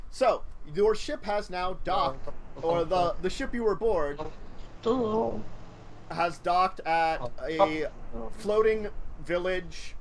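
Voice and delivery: slow game master narration; scene setting. Acted. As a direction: paint the scene slowly.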